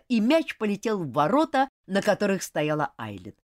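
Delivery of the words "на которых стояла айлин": plain reading voice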